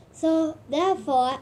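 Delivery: usual speaking voice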